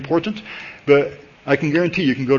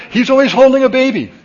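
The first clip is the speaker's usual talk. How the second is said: high, loud burst of comic insistence; emphatic exclamation; 11 semitones higher, 8 dB louder than usual. Real, not acted. Preacher joking to congregation about a member, high emphatic exclamation. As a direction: exclaim it loudly, pitch jumping right up. Exclaiming over man always carrying babies.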